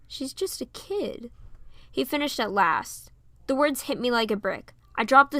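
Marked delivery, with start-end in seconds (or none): 0.1-1.3 slow, softer, dawning sadness; quiet realization. A narrator's reading. Eugene realizes Piper is only a child, in a slow quiet voice. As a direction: let the truth sink in. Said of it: "a look of realization".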